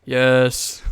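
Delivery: deep voice. Shown as a demonstration. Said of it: "Just saying yes in a deep voice".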